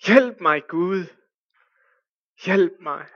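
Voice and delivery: loud plea to God; crying out to God. Retold or imitated. Climber cries to God in the storm, loud plea. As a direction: call out to God for help. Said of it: "han råber igen".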